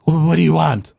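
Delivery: gruff villain. Acted. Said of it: "and he goes".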